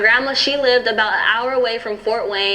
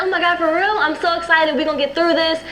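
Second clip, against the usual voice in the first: high, happy burst of excitement; boyfriend's retold joy at the pregnancy; excited; 6 semitones higher than usual. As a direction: gush about the news. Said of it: "going on about how happy he was".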